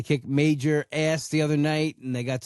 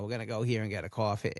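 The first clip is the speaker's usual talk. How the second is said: low, soft fry with COFFEE stretched out; mock vocal fry, dragging the ending on and on; dragged-out last word; 4 semitones lower, 7 dB quieter, faster than usual. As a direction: imitate a creaky speaker who won't let the last word go. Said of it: "they drag on the last thing they said".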